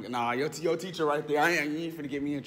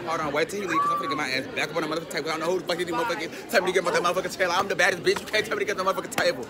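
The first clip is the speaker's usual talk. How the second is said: fast, louder fired-up rant; mock indignant rant; 4 semitones higher, 5 dB louder, faster than usual. Real, not acted. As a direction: rant fast about the teacher. Ranting about teacher.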